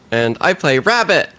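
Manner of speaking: usual speaking voice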